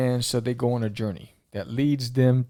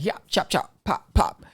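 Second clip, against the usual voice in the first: fight noises; 6 semitones higher than usual. Pretend. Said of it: "they're the ones who are like".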